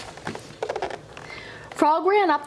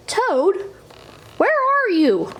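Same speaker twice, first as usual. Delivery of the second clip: higher calling into a dark house; 8 semitones higher, slower than usual. A narrator's reading. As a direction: call out to find a friend. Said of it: "called Frog".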